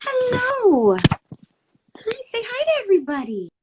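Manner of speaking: high sing-song pet talk. Real, not acted. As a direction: coo at the kitty. Talking baby talk to cat.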